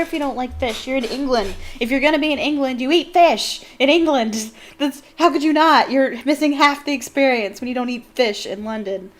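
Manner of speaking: insist, getting heated as you go; worked-up rant. Real, not acted. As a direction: passionate push that everyone should eat fish. Insisting people eat fish.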